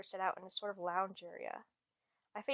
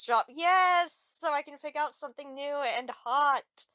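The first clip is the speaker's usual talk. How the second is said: excited; 5 semitones higher, 9 dB louder, slower than usual. Acted. Excited about clothes shop.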